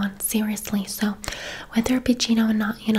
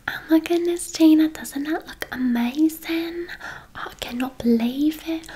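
put-on excited English accent; 6 semitones higher than usual. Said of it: "I'll start doing an English accent".

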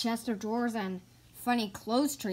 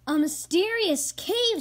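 higher and louder, wide-eyed wonder; excited discovery; 9 semitones higher, 6 dB louder than usual. Acted. Bear Scouts marvelling at the mysterious cave. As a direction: gasp it out in awe.

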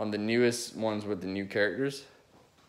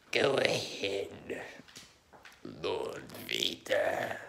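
the Emperor; 5 dB quieter, much slower than usual. Retold or imitated. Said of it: "Okay, the Emperor".

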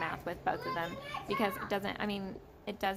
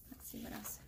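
whispering; 10 dB quieter than usual. Real, not acted.

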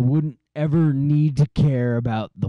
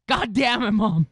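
frustrated shout; 12 semitones higher than usual. Real, not acted. Frustrated outburst at mom.

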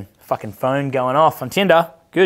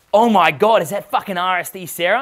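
excited recognition; 6 semitones higher than usual. Retold or imitated. Spotting Sarah at the club, he cries out high and excited. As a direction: gasp it out like you just saw a celebrity.

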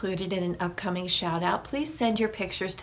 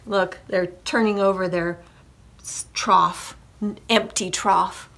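usual speaking voice

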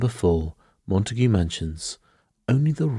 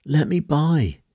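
high-pitched panicked cry; urgent, high cry of terror; shriek; 9 semitones higher, 4 dB louder, slower than usual. A narrator's reading. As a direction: shriek to get past. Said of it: "she shrieked".

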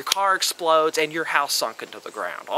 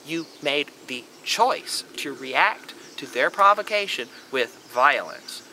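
ordinary delivery

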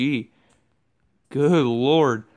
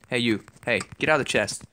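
ordinary talk

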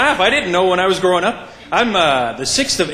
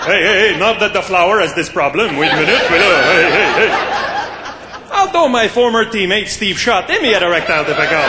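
Guy Lafleur. Acted. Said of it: "My name, she is Guy Lafleur".

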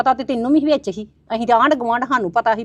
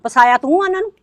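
high, loud blame; accusing shout; 7 semitones higher, 6 dB louder than usual. Acted. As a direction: throw the blame at her loudly. Accusing neighbour in quarrel.

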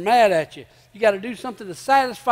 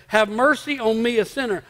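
ordinary delivery

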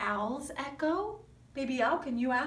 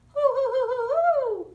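high, loud hooting; sung owl hoo for the children; 9 semitones higher, 7 dB louder than usual. Acted. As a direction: hoot it like a baby owl. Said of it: "baby Al".

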